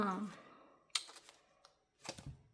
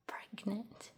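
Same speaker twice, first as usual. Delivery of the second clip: whispered; 6 dB quieter than usual. Real, not acted. Whispering a pregnancy announcement.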